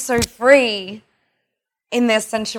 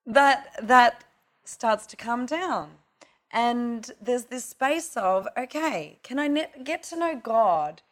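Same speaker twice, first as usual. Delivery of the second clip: ordinary talk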